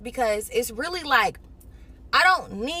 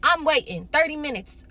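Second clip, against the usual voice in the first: ordinary delivery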